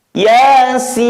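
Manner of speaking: Yasin with sin held three alif long; long drawn Yasin. Shown as a demonstration. Teacher chanting Yasin to students, sin drawn long. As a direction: stretch the sin in Yasin to six beats. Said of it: "Kita praktekkan".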